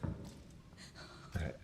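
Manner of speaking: breathy whispered aside; whispering. Real, not acted. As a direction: whisper it to yourself. Bracing to continue playing.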